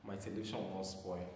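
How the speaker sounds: usual speaking voice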